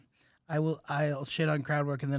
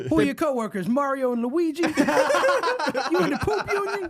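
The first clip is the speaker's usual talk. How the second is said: laughing mock comic voice; 12 semitones higher, 5 dB louder than usual.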